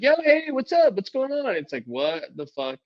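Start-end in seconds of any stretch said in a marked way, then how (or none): 0.0-1.5 much higher fake-casual greeting; fake-chummy hello from the cops; mock cop greeting. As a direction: cops on the stoop, fake-friendly and high. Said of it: "they're like".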